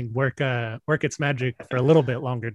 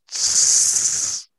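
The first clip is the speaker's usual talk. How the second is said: dismissive hiss sound. Retold or imitated. Texas backing down, imitated noise.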